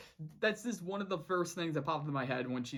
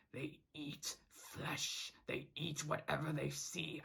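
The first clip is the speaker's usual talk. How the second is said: slow hushed dread; slow, menacing, hushed captain; ominous whisper; 8 dB quieter, slower than usual. Retold or imitated. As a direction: whisper it like a grim warning.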